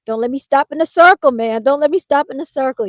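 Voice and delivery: high, loud panicked pleading; a hammed-up retold panic; anxious pleading. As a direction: beg the car not to stall, loudly. Retold panic in the traffic circle.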